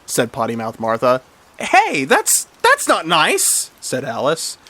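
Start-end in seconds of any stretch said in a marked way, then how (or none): 1.6-3.7 high-pitched protest, slowing at the end; object, high and hurt; acted affronted squeal; offended girl. A narrator's reading. Alice protests the insult.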